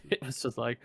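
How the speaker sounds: ordinary talk, nothing marked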